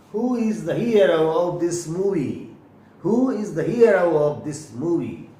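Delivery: very slow, every word spaced; slow example sentence. Shown as a demonstration. Teacher reading a sample sentence slowly to the class. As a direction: drag out the sentence, leaning on hero. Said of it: "इसका sound होगा hero".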